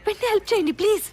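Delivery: high, urgent shouted plea; shouting. Acted. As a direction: beg loudly for immediate help. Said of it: "ఎందుకు అరుస్తున్నావు madam?".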